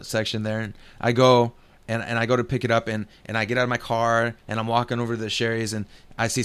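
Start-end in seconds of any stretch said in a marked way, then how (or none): none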